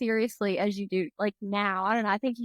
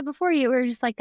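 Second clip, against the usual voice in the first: ordinary talk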